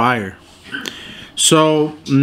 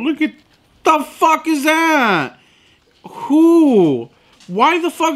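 indignant disbelief; 12 semitones higher, slower than usual. Real, not acted. Complaining about sauce cup.

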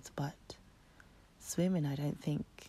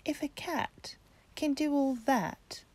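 excited kitten; 8 semitones higher, 10 dB louder than usual. Acted. Kitten reasons, then squeals.

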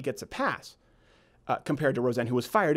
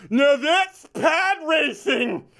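high, very slow mock yell; dad's yell; 10 semitones higher, 4 dB louder, much slower than usual. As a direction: bellow it out across the theater. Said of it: "My dad would yell".

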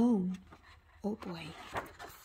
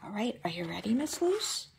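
whisper; 4 dB quieter, faster than usual. Real, not acted. Checking on sleepy child.

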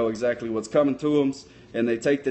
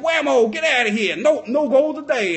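high, punchy block; excited, pitched-up sports retelling; triumphant goalkeeper; 9 semitones higher, 4 dB louder than usual. Retold or imitated. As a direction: crow over a blocked shot.

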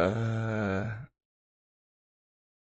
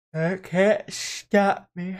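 dramatic defiance; 8 semitones higher, 8 dB louder, much slower than usual. Pretend. Defiant about remote work.

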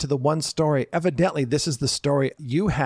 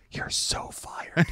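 hushed whisper across the dock; 6 dB quieter, much slower than usual. Retold or imitated. Coworker across the dock whispering to the skipper, hushed whisper. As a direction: breathe it out with no voice, slow and secret. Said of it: "He goes".

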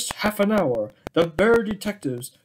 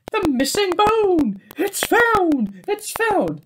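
excited cry; 13 semitones higher, 4 dB louder than usual. Acted. Bears find the bone.

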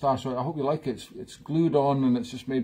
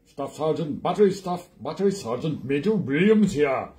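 put-on Welsh sergeant major; terrible Welsh accent. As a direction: do a badly faked Welsh accent. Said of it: "this terrible Welsh accent".

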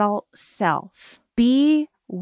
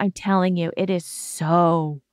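normal talking voice

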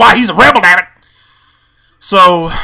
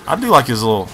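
ordinary talk, nothing marked

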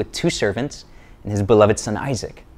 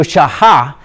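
Hebrew accent; 3 semitones higher, 7 dB louder than usual. Shown as a demonstration.

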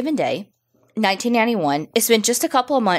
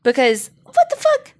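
incredulous. Real, not acted. Telling the story to her cohost, incredulous high squeal. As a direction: squeal it out in disbelief. Outraged rhetorical exclamation.

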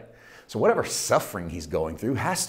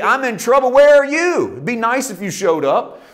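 much higher, louder mock demand; exasperated complaint; 13 semitones higher, 11 dB louder than usual. Preacher paraphrasing psalmist's complaint to God. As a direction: call out to an absent God. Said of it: "Quite simply".

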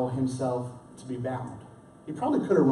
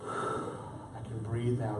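relieved sigh; 4 semitones lower, 9 dB quieter, much slower than usual. Retold or imitated.

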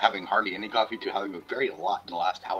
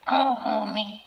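pitched up Marge saying homie; Marge Simpson voice; 13 semitones higher, 5 dB louder, much slower than usual. Retold or imitated. Retelling the shop corner, doing Marge, higher and louder. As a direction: coo it like Marge. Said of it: "how do you like my, uh, Marge Simpson".

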